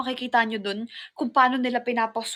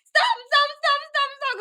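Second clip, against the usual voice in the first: high, loud terrified stop; panicked screaming; 13 semitones higher, 4 dB louder than usual. Retold or imitated. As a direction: shriek it out in fear. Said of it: "Sumisigaw talaga ako".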